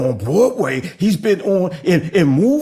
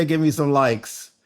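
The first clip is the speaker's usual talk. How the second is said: ordinary talk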